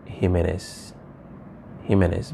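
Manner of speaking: Jimenez softly, middle syllable unstressed; no accent stress. Shown as a demonstration. Teacher shows students no accent, Jimenez soft and unstressed. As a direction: say Jimenez lightly without leaning on MEN. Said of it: "if this accent was not here".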